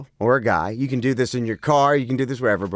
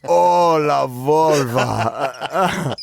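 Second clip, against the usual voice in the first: mock moan; much slower than usual. Showing La Volva isn't very sexy, mock moan. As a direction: stretch the name into a big pretend moan. Joke-moaning the suggested name.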